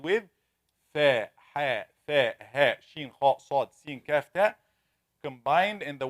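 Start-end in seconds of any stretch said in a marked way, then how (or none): none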